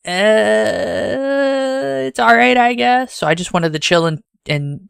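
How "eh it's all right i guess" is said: mock shrug. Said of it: "And then it's like".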